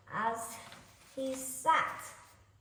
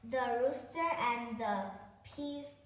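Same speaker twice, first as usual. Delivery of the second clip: ordinary delivery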